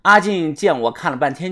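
ordinary delivery

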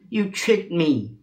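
high, slow, mildly indignant; acted protest, drawn out; indignant accusation. Monkey accuses monk, stamping foot.

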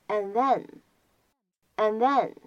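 "and then" with the D of "and" unreleased; incomplete plosion. Shown as a demonstration. Teacher demonstrating incomplete plosion in "and then". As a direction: hold back the D, gliding from "and" into "then". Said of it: "可以有一个不完全爆破".

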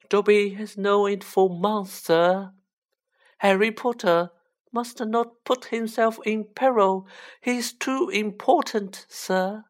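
high, earnest elf voice; small servant creature, high and emphatic; Dobby, insistent house-elf. Acted. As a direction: plead high with the young master.

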